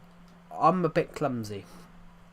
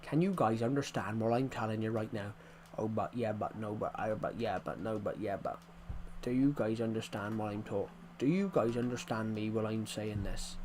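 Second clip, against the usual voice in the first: mumble-free but heavy English dialect; thick English accent; 2 semitones lower, 3 dB quieter than usual. Shown as a demonstration. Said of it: "it's thick English".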